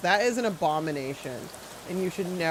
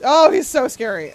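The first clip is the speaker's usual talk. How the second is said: startled shriek; 6 semitones higher, 11 dB louder than usual. Real, not acted. Startled by a creepy game character.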